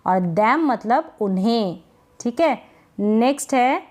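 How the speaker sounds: ordinary talk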